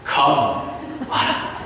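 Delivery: gentle hushed invitation; quietly inviting Peter over; soft whispered call. Shown as a demonstration. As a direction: whisper come with a smile. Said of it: "아니면".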